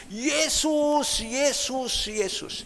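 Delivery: high, loud jeering call from afar; mock the name across the pool; a neighbour's taunting yell, fading; mocking shout. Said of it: "dia teriak dari jauh".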